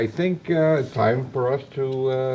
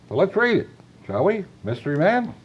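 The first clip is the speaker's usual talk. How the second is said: bright, louder, higher; upbeat host announcement; 7 semitones higher, 4 dB louder than usual. Real, not acted.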